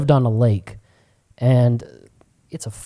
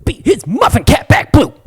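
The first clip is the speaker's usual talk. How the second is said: high, loud comic holler; Kevin Hart impression; 12 semitones higher, 7 dB louder than usual. Quoting bits said all through school, loud holler. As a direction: shout it as a stand-up catchphrase. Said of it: "say anything by Kevin Hart".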